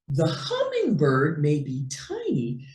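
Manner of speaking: plain reading voice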